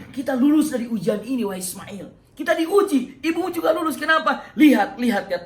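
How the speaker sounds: high, loud, overjoyed telling; elated announcement. Acted. Ibrahim telling Ismail they passed, high and overjoyed. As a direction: tell it bursting with joy. Ibrahim explains the joyful news.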